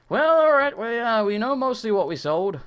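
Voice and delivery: loud, strained resignation; exasperated. Real, not acted. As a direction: push through the frustration loudly. Exasperated restart after losing items.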